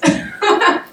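sudden high breathy laugh; burst of laughter. Real, not acted. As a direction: laugh out loud with excitement. Excited laugh about the Chiefs.

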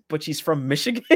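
normal talking voice

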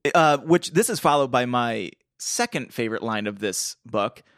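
ordinary talk

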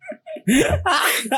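high, breaking laugh; high laugh burst. Real, not acted. Laughs at María Palito. (carcajada aguda)

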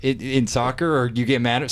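usual speaking voice

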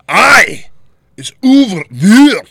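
loud, high, overdone fake Scottish accent; mock Scottish accent. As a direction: belt out a hammed-up Scots "Aye", drawn out. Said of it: "this is how I talk".

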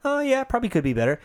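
high, fast mock agreement; mocking concession. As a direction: play a reluctant yes-man, quickly. Mocking a cop supporter.

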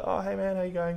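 bright, high hello; cheery greeting. Retold or imitated. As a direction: greet a friend in passing, upbeat.

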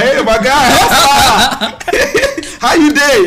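loud, high call across the room; booming pidgin hello; loud Nigerian greeting. Retold or imitated. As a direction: hail a friend from across the room, laughing. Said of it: "we are very loud".

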